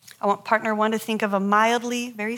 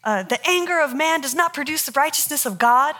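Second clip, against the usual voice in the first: retold yell back; 7 semitones higher than usual. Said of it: "I yell right back at her".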